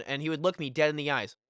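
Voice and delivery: usual speaking voice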